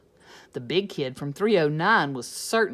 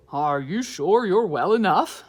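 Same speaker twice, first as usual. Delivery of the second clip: louder principal voice; lightly voiced principal; 9 dB louder than usual. Principal checking on the teacher, louder.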